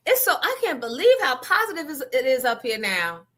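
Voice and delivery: high gushing mock voice; mocking her gushing. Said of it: "just kept with the".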